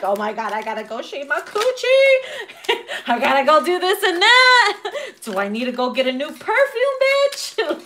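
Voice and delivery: high, loud, giggly panic; flustered squealing before a date; panicky excitement. Retold or imitated.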